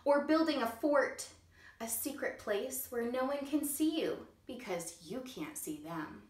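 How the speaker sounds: ordinary delivery, nothing marked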